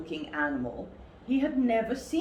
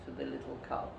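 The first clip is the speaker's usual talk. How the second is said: ordinary delivery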